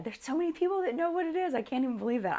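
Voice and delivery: high, excited disbelief; gasping with surprise; shocked. Real, not acted. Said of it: "I'm shocked".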